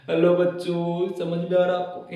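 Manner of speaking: imitating a drained teacher; mock low energy. Teacher showing students how he'd teach without energy, mock listless. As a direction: teach as if the energy has run out, lightly put on. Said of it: "energy नहीं आ रही, ऐसे पढ़ाता हूँ".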